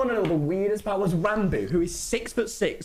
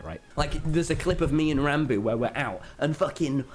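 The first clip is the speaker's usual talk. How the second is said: usual speaking voice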